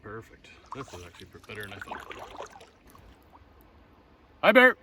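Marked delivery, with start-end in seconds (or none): 4.4-4.8 loud high call to warn wildlife; calling out to bears. Real, not acted. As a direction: sing out loudly to announce yourself.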